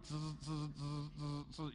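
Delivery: short soft buzzing bursts; imitated machine buzz. Preacher describing the claw machine, imitated buzz. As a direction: buzz like a little claw motor.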